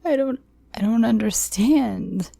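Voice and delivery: high, crying stammer; frightened pleading, voice up high; tearful plea. Acted. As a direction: stumble over the words through tears. Narrator pleading in tears.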